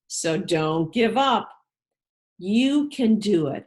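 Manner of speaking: plain reading voice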